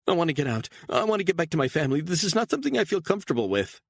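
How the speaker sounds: high, fast, desperate; pleading woman, rushing. A narrator's reading. Captive woman begging to go home, high and rushing. Beatrice voiced by narrator.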